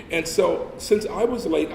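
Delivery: usual speaking voice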